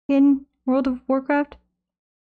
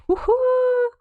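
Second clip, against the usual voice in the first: excited cheer; 13 semitones higher than usual. Acted. Cheering at beach chat message.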